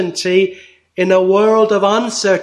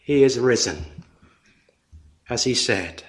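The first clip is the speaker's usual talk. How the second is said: much lower and softer, almost to himself; hushed; 7 semitones lower, 6 dB quieter than usual. Acted. Preacher hushing the congregation on the resurrection, soft and low. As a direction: let the words fall quietly. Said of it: "hushed repeat".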